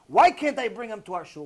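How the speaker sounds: ordinary delivery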